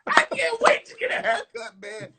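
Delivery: high laughing squeal; giddy laughing about a haircut. Real, not acted.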